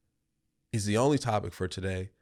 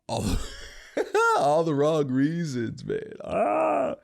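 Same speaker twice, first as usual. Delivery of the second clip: laughing; 7 semitones higher, 4 dB louder than usual. Real, not acted. Laughing through the line.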